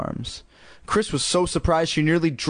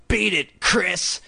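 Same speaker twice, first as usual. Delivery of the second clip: higher-pitched, raspy evil dummy; harsh raspy snarl; 5 semitones higher, much slower than usual. A narrator's reading. Dummy driving the sister away, snarling. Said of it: "snarled in a harsh, raspy voice".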